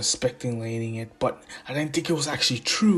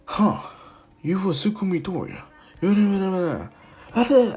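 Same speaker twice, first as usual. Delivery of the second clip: high dismissive Ida; 8 semitones higher, 4 dB louder than usual. Retold or imitated. Ida's dismissive reply.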